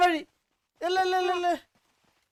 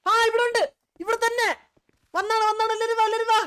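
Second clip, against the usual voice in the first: high, loud shout of discovery; excited calling out; 8 semitones higher, 6 dB louder than usual. Real, not acted. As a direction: holler for the whole team to come. Found it, calls everyone over.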